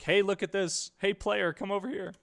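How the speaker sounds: lightly voiced hint guide calling out; game character calling player. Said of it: "for, like".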